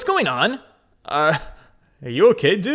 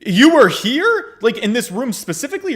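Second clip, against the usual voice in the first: shocked; 8 semitones higher, 7 dB louder than usual. Acted. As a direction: gasp it out in disbelief. Shocked question.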